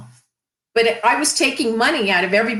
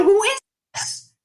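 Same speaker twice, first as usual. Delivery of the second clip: puzzled man; 12 semitones higher than usual. Retold or imitated. Voicing gruff businessman.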